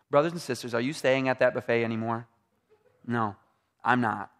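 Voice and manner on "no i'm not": normal talking voice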